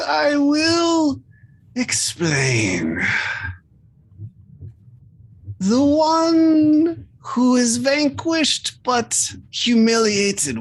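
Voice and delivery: high, solemn creature voice. Acted. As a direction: recite the law as an otherworldly being. GM holds creature voice.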